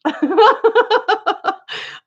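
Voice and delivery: long high laugh mid-thought; sudden laughter. Real, not acted.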